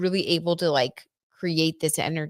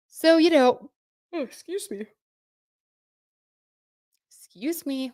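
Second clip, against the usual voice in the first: talking through a yawn; words stretched by a yawn; 9 semitones higher than usual. Real, not acted. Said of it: "That's what my yawns start doing".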